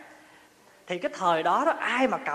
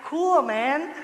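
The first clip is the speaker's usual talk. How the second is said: impressed American; 6 semitones higher, 4 dB louder than usual. Retold or imitated. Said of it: "Có anh to đó".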